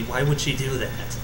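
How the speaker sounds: ordinary delivery, nothing marked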